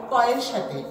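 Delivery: ordinary delivery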